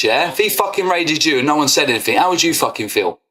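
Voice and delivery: ordinary talk, nothing marked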